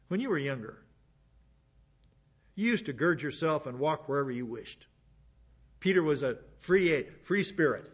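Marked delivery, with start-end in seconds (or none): none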